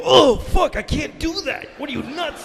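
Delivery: high, loud mock panic; voicing the plane. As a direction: shout it like the plane refusing.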